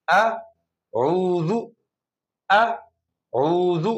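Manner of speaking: very slow اعوذ broken into آ then عوذ; اعوذ spelled out syllable by syllable; split syllables. Shown as a demonstration. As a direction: pull the word apart slowly, آ then عوذ. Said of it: "آپ پہلے آ کریں".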